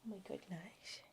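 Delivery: breathy doting whisper. Real, not acted. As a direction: whisper it fondly to the dog. Cooing at the dog.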